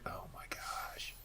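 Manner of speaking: barely voiced, drawn-out whisper; whispered disbelief. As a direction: breathe it out slowly under your breath, very softly. Weary driver retells reaction to sleepers.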